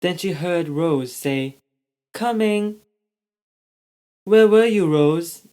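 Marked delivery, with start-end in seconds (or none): 2.1-2.8 higher, small dragon voice; little dragon voice. A narrator's reading. Baby dragon Rose answering Ella, small high voice. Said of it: "Rose said". 4.2-5.5 loud, high call, rising on Rose; calling question. A narrator's reading. Ella calling to find Rose, loud and high. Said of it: "Ella asked".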